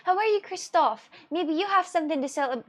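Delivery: ordinary talk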